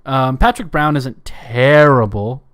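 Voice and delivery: ordinary delivery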